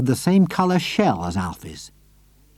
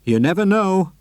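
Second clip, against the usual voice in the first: said darkly; 8 semitones higher, 3 dB louder than usual. A narrator's reading. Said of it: "Mister Hoppy said darkly".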